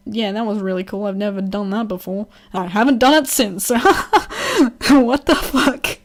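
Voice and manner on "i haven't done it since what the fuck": laughing, high and loud; incredulous laugh at herself; laughing disbelief. Real, not acted. Laughing disbelief at own memory.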